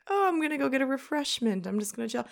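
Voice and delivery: normal talking voice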